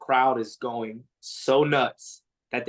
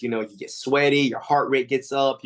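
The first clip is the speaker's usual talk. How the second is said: ordinary talk, nothing marked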